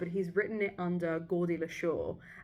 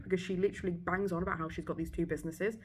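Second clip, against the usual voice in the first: ordinary talk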